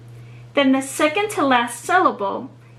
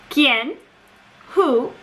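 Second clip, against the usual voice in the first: usual speaking voice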